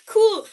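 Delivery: Cool in a high, loud voice; joking pronunciation. Shown as a demonstration. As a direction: pitch Cool up high and punch it. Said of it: "I feel embarrassed saying Cool Whip".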